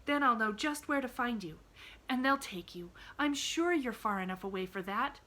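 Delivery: put-on a bright little girl, gently arguing; a hint of an earnest young girl; Pollyanna. A narrator's reading. Pollyanna voiced in story.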